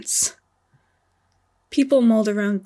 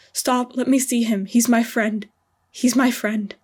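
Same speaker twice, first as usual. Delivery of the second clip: louder, pleading cries through tears; desperate raised plea; tearful shouting; 2 semitones higher, 5 dB louder than usual. Acted. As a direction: beg them loudly to let him see his friend. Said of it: "Sherlock's voice was muffled in tears".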